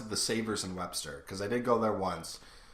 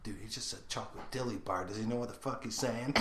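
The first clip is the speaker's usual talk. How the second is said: quiet aside; 8 dB quieter than usual. Retold or imitated. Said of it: "I'm like".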